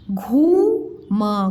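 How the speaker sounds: घूम split, long rising घू, then म; sounded out slowly. Shown as a demonstration. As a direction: sound out घूम in two slow pieces. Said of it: "उच्चारण ऐसे में करके बताया करूँ". (घूम को अलग-अलग तोड़कर, धीरे)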